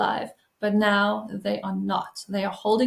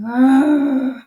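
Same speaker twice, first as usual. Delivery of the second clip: loud, higher frustrated growl of rage; angry growl; 5 semitones higher, 9 dB louder than usual. Shown as a demonstration. Coach demonstrating how to release anger, loud angry growl. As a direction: let the anger burst out through clenched fists. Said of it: "express anger by clenching your fists and just being".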